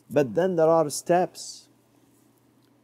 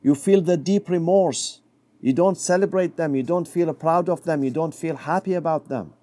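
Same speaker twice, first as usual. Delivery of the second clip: ordinary talk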